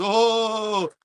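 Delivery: high, gleeful mock delight at the flames; mock gleeful cheer. Vlogger mocking his old self, cheering the fire, gleeful. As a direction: cheer the chaos like a kid. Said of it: "As you guys know, I like to just".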